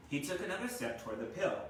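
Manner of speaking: ordinary delivery, nothing marked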